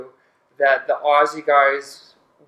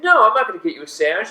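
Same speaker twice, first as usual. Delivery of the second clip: quick, higher-pitched mimicry of a refusal; Gabe; 5 semitones higher, faster than usual. Storyteller mimics a friend refusing a sandwich run, higher quick voice. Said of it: "Yeah, that's the Gabe voice".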